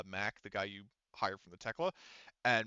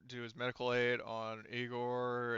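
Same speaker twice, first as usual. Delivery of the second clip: normal talking voice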